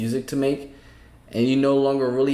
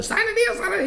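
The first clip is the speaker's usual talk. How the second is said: much higher, squeaky demonic chant; faster than usual. Retold or imitated. Listener repeating the demon manager's demand. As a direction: mimic the possessed manager. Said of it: "a whole demon".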